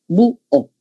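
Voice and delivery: "Bu, o" said as two separate vowel beats; split into syllables. Shown as a demonstration. Teacher showing students an accentual hiatus, "Bu, o" split in two. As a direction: break "Bu, o" apart at the hiatus. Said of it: "Acá hemos separado".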